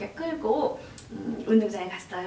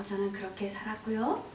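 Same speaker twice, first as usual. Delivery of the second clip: ordinary talk, nothing marked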